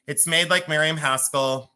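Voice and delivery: usual speaking voice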